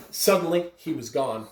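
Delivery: usual speaking voice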